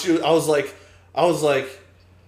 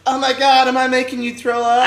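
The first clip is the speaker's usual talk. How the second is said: put-on higher, worried girl quote; 8 semitones higher than usual. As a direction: voice her a bit higher and worried. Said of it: "And she's like".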